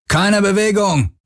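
high, drawn-out yell; barked command. A narrator's reading. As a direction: yell the order at intruders' captives. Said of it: "brüllte einer von ihnen". (gebrüllter Befehl)

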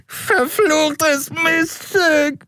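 high, slow, howling with pain; yelping. A narrator's reading. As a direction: wail it out through a broken nose. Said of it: "jaulte er".

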